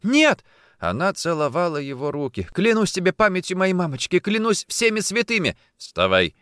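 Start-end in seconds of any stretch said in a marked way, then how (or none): 0.0-0.4 high, loud cry; desperate denial. A narrator's reading. Kneeling woman denies another man, desperate cry. 2.5-5.6 high, fervent pleading vow; desperate swearing. A narrator's reading. Woman kissing his hands swears fidelity, desperate. 5.8-6.3 curt, dry, louder order; dry command. A narrator's reading. Officer to kneeling woman, dry. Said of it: "сухо сказал он".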